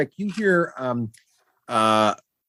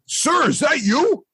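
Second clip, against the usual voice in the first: nervous goblin; 11 semitones higher, slower than usual. Acted. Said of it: "He's like".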